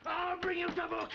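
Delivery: shouted insults and threats; outraged shouting. Acted. Prisoner threatening every man who seized him, outraged shouting. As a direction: hurl abuse at the whole crew.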